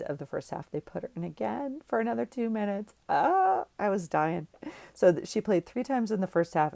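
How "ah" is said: excited squeal. Real, not acted. Daughter put back on field.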